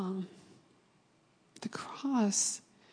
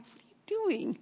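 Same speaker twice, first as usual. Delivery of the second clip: high-pitched, puzzled retold question; 9 semitones higher, 3 dB quieter than usual. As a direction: imitate asking in bewilderment, pitched way up.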